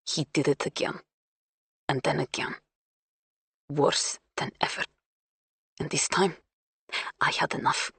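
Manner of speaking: almost whispered, holding back; hushed. Acted. Confession of abuse, hushed.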